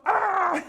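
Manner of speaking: high sudden mock cry of pain; the cop breaking off his brag with a high yelp; retold yelp. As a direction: yelp as the spray finally gets him. Cop hit by spray, falls.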